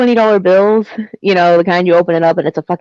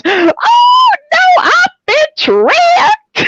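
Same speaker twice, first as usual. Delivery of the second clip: much higher, slow, shouted dismay; imitating the tricked lady; much slower than usual. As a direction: wail it like the joke just landed on her. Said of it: "she goes".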